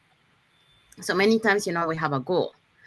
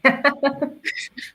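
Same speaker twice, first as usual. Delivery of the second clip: light, high burst of laughter; real short laugh; 7 semitones higher than usual. Real, not acted. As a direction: laugh briefly after fumbling through the names. Laughs after reading out names.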